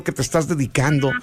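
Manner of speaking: ordinary talk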